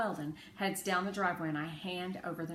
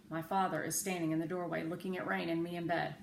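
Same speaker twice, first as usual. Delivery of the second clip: ordinary delivery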